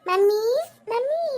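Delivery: put-on small boy calling out, rising on "mummy"; child calling mummy. Son calling his mother from the next room, childlike rising call. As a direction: call for mum like a little kid.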